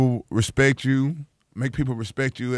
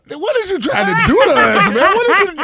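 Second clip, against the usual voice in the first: much higher and louder, laughing as the father; mock horrified dad; 8 dB louder than usual. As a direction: yelp it like a scandalized preacher dad, cracking up. Dad hears the rap name.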